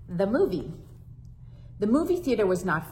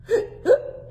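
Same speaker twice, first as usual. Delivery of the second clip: sharp high gasping hiccup intake; 4 dB louder than usual. Acted. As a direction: gasp in like a hiccup. Hiccup gasp.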